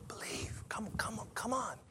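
hushed coaxing whisper. Acted. Jesus whispering to Thomas, coaxing. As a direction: draw him in softly.

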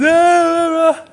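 high mock cries of pain; hammed-up childish ah ah; imitated child crying. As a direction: cry out like a small boy getting a shot. Imitating son crying at shot.

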